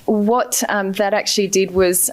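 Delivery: ordinary talk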